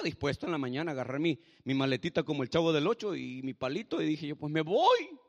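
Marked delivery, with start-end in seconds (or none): 4.3-5.2 higher, louder outburst, acting his past self; defiant resolve. Man with his little suitcase decides to leave, defiant resolve. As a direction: blurt it out, fed up.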